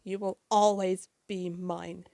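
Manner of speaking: put-on villain taunting the trapped girl, slow and loud; menacing villain. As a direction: lean on always, slow and heavy. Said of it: "And he says".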